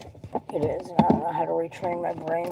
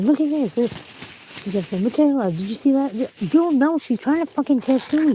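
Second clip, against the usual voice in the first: pitched way up and louder, a mocking take on him; hammed-up higher, louder impression of a nagging man; mocking imitation of him; 9 semitones higher, 5 dB louder than usual. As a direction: mimic him high and loud to ridicule him. Mimicking him nagging her.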